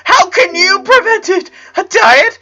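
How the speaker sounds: put-on frightened boy crying out his questions; panicked crying. A narrator's reading. Panicking Clyde questions Lincoln.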